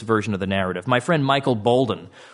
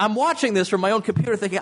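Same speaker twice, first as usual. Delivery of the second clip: usual speaking voice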